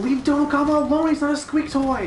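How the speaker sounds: loud high-pitched protest; high shout. Real, not acted. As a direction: yell it in high outrage. Protesting the monster being hurt.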